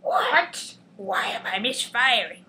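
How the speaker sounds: hammed-up shock building to a shout; alarmed villain. Scientist misfiring, panicked cry of alarm. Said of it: "And he's all".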